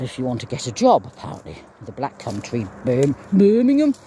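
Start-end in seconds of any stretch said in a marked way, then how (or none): 2.8-3.9 Birmingham in a broad Brummie accent, higher and louder. Shown as a demonstration. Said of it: "That's how you say Birmingham in, uh, Brummie".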